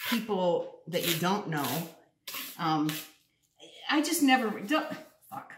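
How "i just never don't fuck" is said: flustered. Real, not acted.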